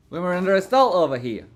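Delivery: hammed-up indignant cry; mock outrage. Streamer mocking chauvinists in chat with hammed-up alarm.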